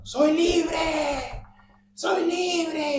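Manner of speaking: high, loud cry, drawn out; imitated shouting. Drunk passenger yelling from the bus bathroom, shouted. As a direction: yell it out like the man in the bathroom. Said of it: "comienza a gritar en el baño".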